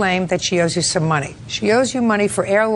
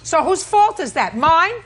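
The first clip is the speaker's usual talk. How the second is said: scolding; 8 semitones higher than usual. Real, not acted. Judge grilling defendant.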